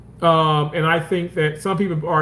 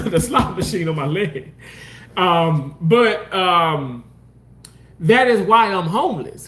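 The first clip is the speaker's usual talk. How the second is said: normal talking voice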